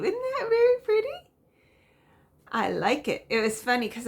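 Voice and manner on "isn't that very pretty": pitched way up, cooing; delighted high coo at a pretty view. Acted. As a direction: coo it high and sweet over the picture.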